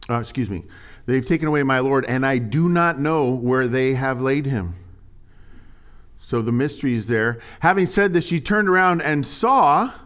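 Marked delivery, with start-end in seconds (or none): 1.1-4.7 raised, measured Bible reading; lifted reading voice; scripture reading. Acted. Reads scripture aloud. 7.6-9.9 raised, measured Bible reading; lifted reading voice; scripture reading. Acted. Reads scripture aloud, building.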